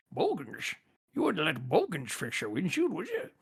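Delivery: lightly put-on Scottish film star, quiet; Sean Connery. Shown as a demonstration. To cohost after failing Australian, a soft, lightly done Sean Connery. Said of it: "I'll just do it as Sean Connery".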